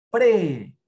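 pre said loud and sharp; syllable pre punched. Shown as a demonstration. Teacher models pre loudly for the class. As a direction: hit pre hard for the kids. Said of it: "vamos a ver con la".

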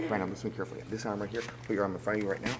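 soft, low hypnotist's suggestion; hypnotic voice. Acted. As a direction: lull him under with quiet commands. Hypnotist inducing trance.

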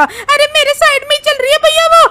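high, loud cry of alarm; panicked squeal. Acted. Companion reports the ghost beside her, panicked high yell. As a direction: squeal that danger is right beside you.

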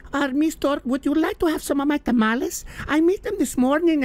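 sweetly offering tamales in a granny voice; old Mexican woman. Retold or imitated. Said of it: "a little Mexican woman out, like".

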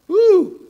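high loud whoop of joy; excited whoop. Real, not acted. Preacher to congregation, excited high whoop. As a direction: let out a sudden whoop.

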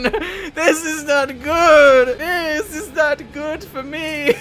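high, slow fearful whine; high, slow whimpering about the horde; whiny dread. Real, not acted. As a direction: moan in mock despair. Horde arrives, alone.

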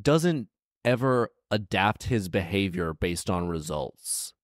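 ordinary delivery

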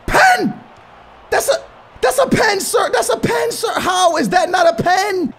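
outraged protest. Real, not acted. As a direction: demand the penalty in disbelief. Penalty not given, protests.

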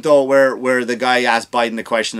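usual speaking voice

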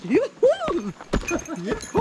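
calling out. Real, not acted. Calling out on mountain.

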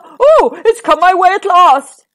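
troll thinks dinner has finally come; greedy troll shouting with joy; troll crying out in glee. A narrator's reading. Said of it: "cried the Troll".

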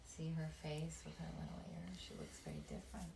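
soft whisper over the picture; whispered aside. Real, not acted. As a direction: whisper as you show the page. Showing the picture to children.

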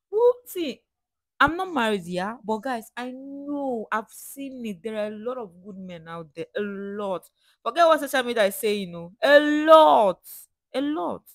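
loud, high and impassioned; emphatic insistence. Real, not acted. Woman assuring followers that good men exist, emphatic and loud. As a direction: insist at full voice, stretching the key words.

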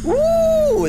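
high, loud drawn-out ooh; excited whoop. Real, not acted. Gamer spotting a nasty new weapon, excited whoop.

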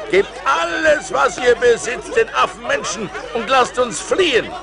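rally the crew to flee; calling out to the men. Acted. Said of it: "Ich rief den Männern zu". (den Männern laut und hoch zurufen)

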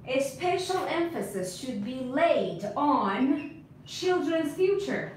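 drawn-out model sentence for learners; slow dictation. Shown as a demonstration. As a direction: give each word plenty of time. Teacher models full sentence.

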